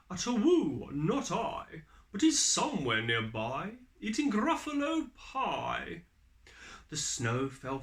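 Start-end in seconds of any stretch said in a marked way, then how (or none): 0.1-6.0 higher, louder owl voice with a hoot. Acted. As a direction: hoot the answer like a showy owl. Owl hoots an answer.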